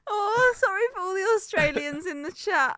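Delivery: can barely get the words out for laughing; helpless giggly laughing at a friend's bad Aussie accent. Real, not acted. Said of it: "I'm crying".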